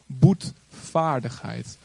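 "boetvaardigheid" spelled out very slowly; very slow, deliberate "boetvaardigheid". Real, not acted. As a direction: linger on each syllable of "boetvaardigheid". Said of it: "even een ouderwets woord".

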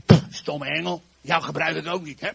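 loud acted grumbling at the tackle; grumpy. Shown as a demonstration. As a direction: huff and curse it like a sulker. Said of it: "hoe vis je nou zagrijnig?".

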